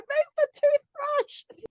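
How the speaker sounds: very high, drawn-out panicked cry; mock urgent warning. Parent holding babies over the sink, mock urgent warning. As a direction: wail it in mock alarm.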